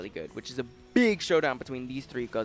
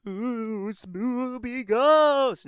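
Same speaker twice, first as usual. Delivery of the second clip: drawn-out, very slow ghostly "ooh", rising higher; cartoon ghost voice, stretched out and mocking; mock spooky ghost; 9 semitones higher, 6 dB louder, much slower than usual. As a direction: ham up a cheesy ghost moan to make fun of it. Mocking a cliché ghost scare.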